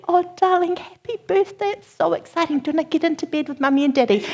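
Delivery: high, soft, forced enthusiasm; mustered excitement. Retold or imitated. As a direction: act excited for a small child while exhausted. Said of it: "muster up the strength to be excited".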